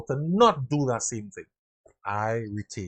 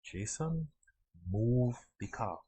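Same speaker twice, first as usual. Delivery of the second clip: softer and slow, unruffled supervisor; calm quiet order; 4 semitones lower, 10 dB quieter, slower than usual. Retold or imitated. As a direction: repeat it calmly, softly and slowly. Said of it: "The supervisor simply said".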